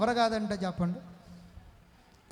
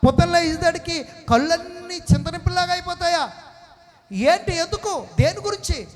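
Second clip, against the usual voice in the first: raised, urgent preaching voice, pressing the crowd; 6 semitones higher, 6 dB louder than usual. Acted. Pastor presses congregation on their nightly tears, preaching loud. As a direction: push each question harder at the congregation.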